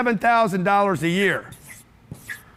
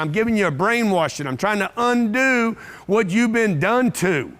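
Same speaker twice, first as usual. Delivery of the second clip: ordinary talk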